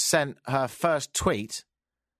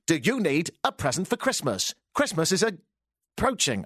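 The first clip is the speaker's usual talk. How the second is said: uber-cheesy radio ad read; swooping, hammy commercial pitch; cheesy plug voice; 3 semitones higher than usual. Shown as a demonstration. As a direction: sell it ridiculously cheesy. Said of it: "do it in a cheesy voice".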